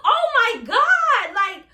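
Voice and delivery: high, drawn-out exclamation; high-pitched dramatic outburst; exasperated outcry. Real, not acted. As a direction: cry out in exasperation, stretching it long. Exasperated outburst about a turn-off.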